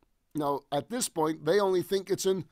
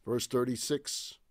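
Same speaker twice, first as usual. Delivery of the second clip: usual speaking voice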